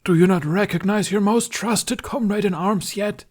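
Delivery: high, commanding king's call; ordering as Arthur. A narrator's reading. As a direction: summon the knights like a stage king. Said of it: "Tooth ordered as Arthur".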